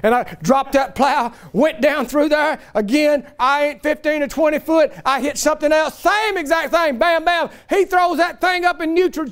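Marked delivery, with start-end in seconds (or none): none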